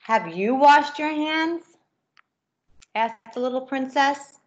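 lively storybook reading with character voices; reading to children. A narrator's reading. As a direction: read it brightly to a class of kids. Reads picture book to children.

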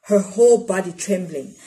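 plain reading voice

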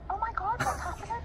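high-pitched mock panic, retold; panicked. Actress recalling the cast's panic, high mock alarm. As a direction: squeak it out like the cast losing it. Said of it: "we were all just like".

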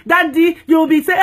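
ordinary delivery

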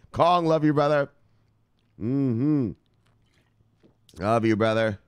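usual speaking voice

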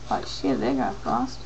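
ordinary talk, nothing marked

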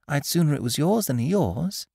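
high, timid small animal; earnest little piglet. Acted. Piglet character voice.

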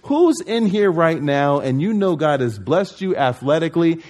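ordinary talk

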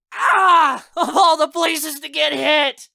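high, loud pained cry; yelling. A narrator's reading. Player hit by paintball, yelling. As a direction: yell it out, slow and outraged. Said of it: "he yells".

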